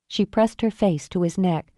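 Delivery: plain reading voice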